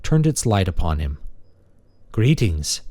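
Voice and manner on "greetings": polite robot voice, a touch higher; friendly machine greeting; warm feminine robot. Acted. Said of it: "it said in a warm, feminine voice".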